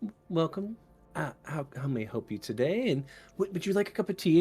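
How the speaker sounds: mousy, calm librarian. Acted. Librarian offers tea to a visitor, mousy and calm. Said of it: "very mousy".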